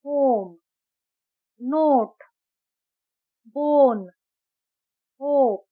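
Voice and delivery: होम नोट बोन होप with a long o, each word set apart; long o sound. Shown as a demonstration. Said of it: "ओ का ओ sound सुनाई दे रहा है जब हम कहते हैं". (लंबा ओ साउंड)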